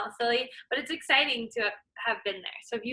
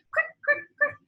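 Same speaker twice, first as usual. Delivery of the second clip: much higher crick crick; hopping sound word. Acted. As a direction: bounce each crick. Rabbit bounding sound.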